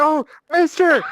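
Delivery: frantic woman. Acted.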